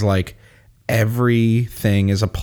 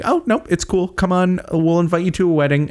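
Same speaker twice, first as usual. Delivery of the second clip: high, airy brush-off; acted-out casual invitation; breezy false reassurance; 9 semitones higher than usual. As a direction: wave it away as no problem. Voices bad guys feigning okay.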